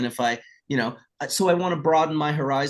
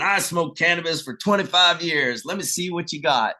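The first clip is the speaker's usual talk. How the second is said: cocky Texas guy; 6 semitones higher than usual. Retold or imitated. Older Texas guy daring the speaker to impress him, lightly voiced boast. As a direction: brag like a seasoned smoker who thinks he's seen it all. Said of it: "this Texas guy going".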